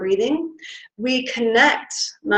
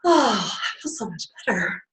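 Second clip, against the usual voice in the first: relieved sigh; 2 semitones lower, 8 dB quieter than usual. Real, not acted. Speaker feeling the breath relax her. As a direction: melt into relief. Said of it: "even standing up here going".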